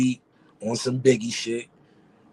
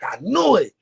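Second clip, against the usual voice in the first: higher and louder, triumphant; excited; 8 semitones higher, 5 dB louder than usual. Retold or imitated. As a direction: celebrate like you called it. Bryce jumping in the air.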